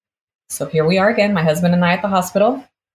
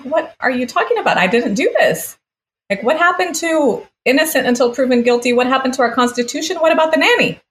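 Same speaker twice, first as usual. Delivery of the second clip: high, loud pleading questions, voice climbing; outraged disbelief; 10 semitones higher, 4 dB louder than usual. Retold or imitated. Retelling arguing with the lawyer, high outraged disbelief. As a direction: protest like you can't believe what you're hearing.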